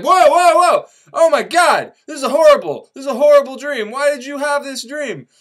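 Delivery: loud, high shocked outcry; whoa, high and loud, horrified; alarmed Adam. Retold or imitated. Adam waking from a terrible dream, loud and high. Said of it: "Adam's like".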